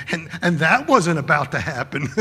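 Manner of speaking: chuckling through the line; imitating a chuckling pastor; laughing. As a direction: laugh it off as no big deal. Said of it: "eh, laughing".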